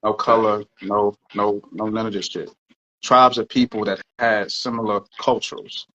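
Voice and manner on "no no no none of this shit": ordinary talk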